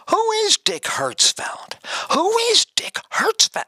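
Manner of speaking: high, strained roll-call question; baffled teacher calling. Retold or imitated. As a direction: imitate a teacher searching the room for a name.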